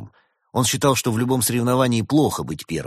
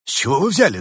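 high, louder nervous question; 9 semitones higher, 7 dB louder, faster than usual. A narrator's reading. Rabbit doctor is accused of lying, nervous protest. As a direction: get rattled, pitch jumping up. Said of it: "занервничал кролик".

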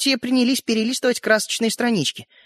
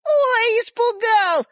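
very high, taunting singsong; mocking; much slower than usual. A narrator's reading. Sister taunts brother from behind door, mocking. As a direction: jeer in a high mock-scared voice. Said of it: "насмешливо раздалось из-за двери".

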